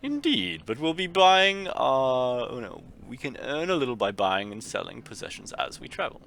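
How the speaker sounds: high, loud posh-gent voice, very high on Indeed; fancy gentleman. Acted. Master answering his valet, in a high fancy gentleman voice. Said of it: "We'll just make him all fancy".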